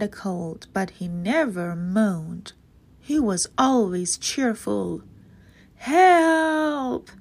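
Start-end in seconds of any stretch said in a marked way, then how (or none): 5.8-7.1 much higher, loud shout; cry for help. A narrator's reading. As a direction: yell for help from the basin. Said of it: "he cried".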